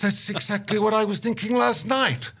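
high-pitched comic vindication; hammed-up exasperation, pitch held high; exasperated agreement. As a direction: wail it as a punchline. Exasperated retort to the elder.

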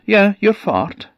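high, bright fart-creature voice; a jolly little fart greeting her; cheery fart creature. Acted. Fart confirms.

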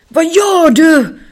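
high, loud, slow panicked cry; frantic alarm at the sudden stop. Acted. Raga skriker i panik när Muffin stannar.

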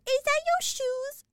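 put-on high, slow kid voice; little girl. Podcaster imitating a little girl asking about shoes, high and childlike.

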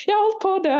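high, mock-wailing plea; mock teary wail about nearly dying; retold teary wail. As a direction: imitate yourself crying it out. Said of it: "begynte jeg bare å grine".

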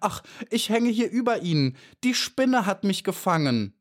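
high, anguished little bee voice; distressed plea. A narrator's reading. Caught bee tells the beetle the spider trapped her, distressed.

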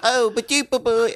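put-on high British-accent gibberish; mock British accent. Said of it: "when she talks to her dad, she's all".

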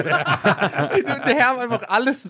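high-pitched laughing rant. Real, not acted. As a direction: laugh through it, slow start. Laughing exclamation.

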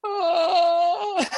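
high squeal through laughter; high whistle scream. Retold or imitated. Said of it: "this really high".

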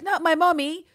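put-on high, pleading little-boy voice; pleading over his mother, Julian whines high; mock childish whine. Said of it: "who's like".

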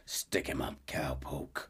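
low, soft, threatening growl; a quiet threat from behind; menacing hold-up. Retold or imitated. Dutch jokingly holds up Arthur at the door, low and soft menace.

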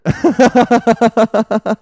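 high loud cackling laugh; long laugh. Real, not acted. Comedian laughing hard at his riff, loud cackle. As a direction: belly laugh at your own riff.

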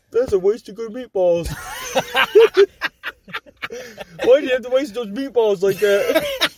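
can't hold back the laughter while quoting; quoting LaPuade while cracking up; laughing quote. Retold or imitated.

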